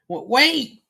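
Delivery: stammered, high, loud call. A narrator's reading. Filly calls after the wolf entering a cave, urgent. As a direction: call after someone leaving, voice jumping up.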